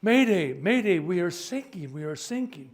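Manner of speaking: loud distress call that fades by the end; mayday call repeated. Acted. Ship repeats its call to the Coast Guard, loud panicked mayday. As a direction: shout it urgently, then let it drop.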